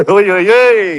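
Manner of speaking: high, loud mock echo of his "ой, ой", laughing. Mimicking the Russian's exclamation.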